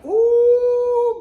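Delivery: long, loud, very high whoop; playful scandalized squeal; sustained high "ooh" before a laugh. Pretend. Mock scandalized reaction to own remark.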